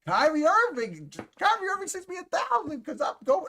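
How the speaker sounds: very high squealy mock voice, laughing; mock giddy girl. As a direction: squeal it like a starstruck fan. Mocking the streamer's excitement.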